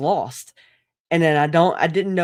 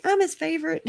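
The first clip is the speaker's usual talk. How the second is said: much higher, smug little voice; smug mock voice; 14 semitones higher than usual. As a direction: play the smug favorite child. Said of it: "She'd be like".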